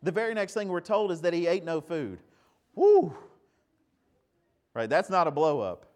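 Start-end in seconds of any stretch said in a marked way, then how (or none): 2.7-3.1 sudden high loud "woo"; excited whoop. Real, not acted. Preacher whooping at the congregation, high and loud. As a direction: let out a delighted whoop. Reacting to Jonathan's calm.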